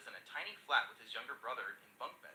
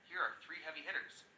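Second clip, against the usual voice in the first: ordinary delivery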